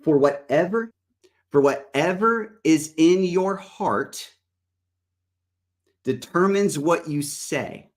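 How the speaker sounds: slow, emphatic Bible reading; measured preacher's reading with long pauses; scripture reading. Real, not acted. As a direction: read the verse out deliberately, weighing each word. Reads scripture aloud.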